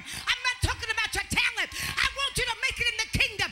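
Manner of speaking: building to a high, loud preaching climax; rising shouted sermon; preaching at full force. Acted. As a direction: whip the congregation up.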